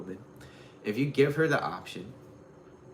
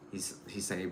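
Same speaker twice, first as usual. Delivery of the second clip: ordinary talk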